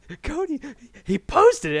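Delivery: high, loud, retold excited outburst; stammering excitement. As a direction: stumble on the name, then burst out. Acts stammering out the news.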